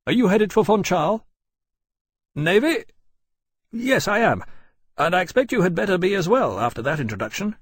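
high-pitched, brisk officer's voice; brusque courier captain. A narrator's reading. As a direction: question him briskly, high and emphatic.

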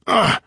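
short hurt groan; pained grunt. A narrator's reading. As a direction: grunt as if being squeezed. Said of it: "then grunted in pain".

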